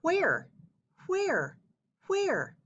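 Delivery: puzzled pondering. Acted. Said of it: "Paul thought for a moment".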